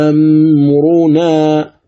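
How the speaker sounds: "جاء أمرنا" with the second hamza softened, very slow; eased hamza. Shown as a demonstration. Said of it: "أعيده".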